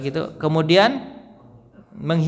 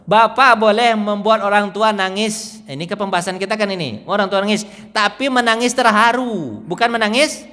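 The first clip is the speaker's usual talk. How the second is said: higher and louder, preaching with rising fervor; fervent preaching; 7 semitones higher, 4 dB louder than usual. Acted. Preacher urging the congregation to make parents weep for joy, fervent and raised. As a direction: build it up like a pulpit climax.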